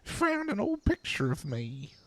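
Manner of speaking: high, wavering granny voice; old lady voice. Retold or imitated. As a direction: croak it out like an old woman. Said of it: "This sounds like me doing an old lady voice".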